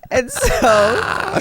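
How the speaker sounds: loud laughing agreement. Real, not acted. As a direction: concede while laughing. Laughing concession.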